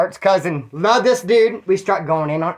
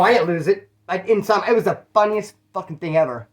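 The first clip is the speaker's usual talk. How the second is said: usual speaking voice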